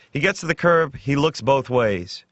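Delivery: usual speaking voice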